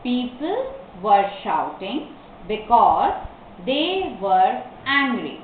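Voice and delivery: slow dictation in short pieces; word-by-word. Shown as a demonstration. Teacher repeats the answer for learners, word by word. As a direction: stop after every word or two.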